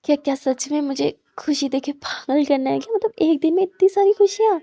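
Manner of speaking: giddy lover almost shouting with delight; overjoyed. Acted. Overjoyed at girlfriend's thanks.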